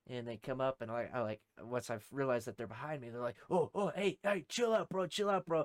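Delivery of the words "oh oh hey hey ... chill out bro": high, urgent calming plea; imitated alarmed appeal. Small group behind him to the armed man, imitated high urgent appeal. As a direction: mimic someone backing off and soothing.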